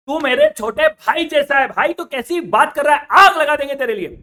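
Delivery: loud, high emotional vow; passionate shout. Acted. Elder baniya loudly vowing to help the Punjabi. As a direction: shout it out like a devoted elder brother.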